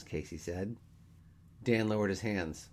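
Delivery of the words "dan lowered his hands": plain reading voice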